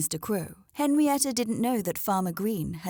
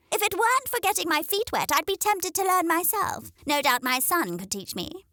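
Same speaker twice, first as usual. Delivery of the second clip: silly smirk; 10 semitones higher than usual. A narrator's reading. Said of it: "remarked with a silly smirk".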